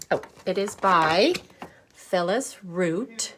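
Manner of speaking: ordinary talk, nothing marked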